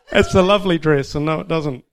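loud, high words through real laughter; laughing. Real, not acted. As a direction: blurt it out while cracking up. Joking answer amid hearty laughter.

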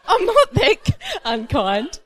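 high, slow, squeaky through laughter; laughing protest. Real, not acted. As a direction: protest while cracking up.

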